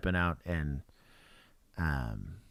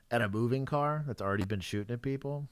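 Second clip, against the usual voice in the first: normal talking voice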